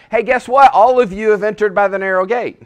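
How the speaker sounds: loud, high, upbeat good news; a preacher mocking false reassurance; cheery announcement. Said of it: "he for sure isn't saying".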